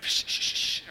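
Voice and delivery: whispered shh, shh to hush them; mock whisper shushing. Peter hushing the excited crowd at night, whispered shushing. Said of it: "and he's like".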